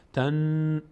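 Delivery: "تن" with sustained nasal n; nasal hold. Shown as a demonstration. Teacher isolating the doubled noon, long nasal n. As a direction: hang on the n of "تن" through the nose.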